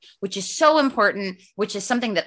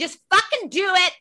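tough love yell; 10 semitones higher than usual. Retold or imitated. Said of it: "I have always been a tough love motherfucker".

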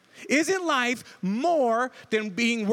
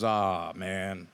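low, sinking aw man as a bird hits; family groaning at a bird thump; mock groan; 14 semitones lower, 5 dB quieter than usual. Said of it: "everybody in our house just goes".

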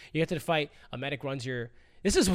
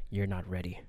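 inner demons voice; 5 semitones lower, 6 dB quieter than usual. Retold or imitated. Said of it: "They're like".

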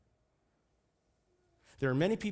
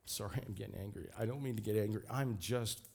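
quieter, lower, backing down; soft apology; 4 semitones lower, 6 dB quieter, faster than usual. Real, not acted. Preacher apologizing to congregation for getting angry, subdued. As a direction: drop the force and apologize under it.

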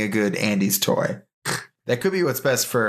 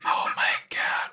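breathless whispered disbelief; 4 dB quieter, slower than usual. Real, not acted. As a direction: whisper it, stunned and amused.

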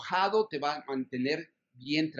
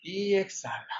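whispered exhale cue; 4 semitones higher than usual. Shown as a demonstration. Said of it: "La última vez".

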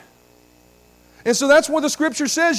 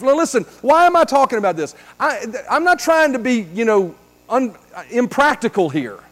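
ordinary delivery

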